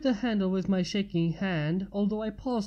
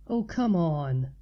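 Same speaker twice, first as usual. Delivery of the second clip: slow, lower exasperated drawl; weary dismissive groan of a friend; eye roll; 3 semitones lower, slower than usual. A narrator's reading. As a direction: roll the eyes in the voice, dragging it out. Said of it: "she said with an eye roll".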